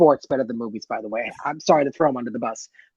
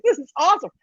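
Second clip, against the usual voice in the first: excited; 12 semitones higher, 3 dB louder, faster than usual. Retold or imitated. I was like.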